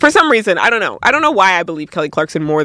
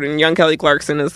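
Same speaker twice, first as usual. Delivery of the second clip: ordinary talk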